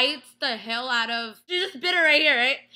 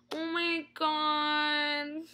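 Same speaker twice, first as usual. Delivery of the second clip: very slow, stretched-out amazed reaction; drawn-out awe; 3 semitones higher, 3 dB quieter, much slower than usual. Retold or imitated. Fan reacting to Catherine caring, drawn-out awe. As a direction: stretch every word in swooning disbelief. Retelling her awed viewer reaction.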